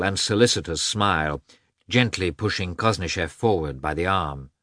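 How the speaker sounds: usual speaking voice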